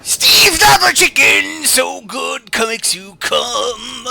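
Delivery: loud, high, over-the-top ad read; cheesy TV ad. Acted. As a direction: sell it like a late-night infomercial. Second mock TV ad.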